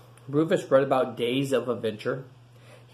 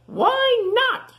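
very high squeaky question; squeaky pig voice; 8 dB louder than usual. Acted. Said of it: "said Rufus".

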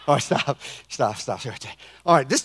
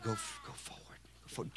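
unvoiced whisper; 8 semitones lower, 26 dB quieter than usual. God's voice guiding Drew forward, whisper. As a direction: breathe the direction softly.

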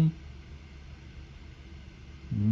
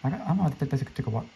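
normal talking voice

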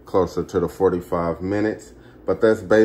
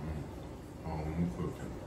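savoring; 6 semitones lower, 17 dB quieter, much slower than usual. Real, not acted. Tasting the cobbler.

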